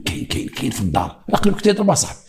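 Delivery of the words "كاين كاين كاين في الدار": low, softened report; hushed tip-off. Retold or imitated. Informant telling police the suspect is home, hushed. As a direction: pass it on quietly, repeating the key word.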